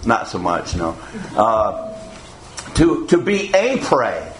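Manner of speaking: ordinary talk, nothing marked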